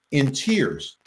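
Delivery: ordinary talk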